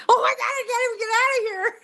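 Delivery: high, fast, laughing through the words; half laughing, half scared in the back seat; laughing panic. Real, not acted. Scared in a police car.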